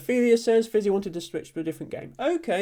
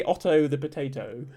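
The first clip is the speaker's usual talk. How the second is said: "Otto the potato" with a posh English "Otto"; posh English accent. Shown as a demonstration. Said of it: "if you're being posh".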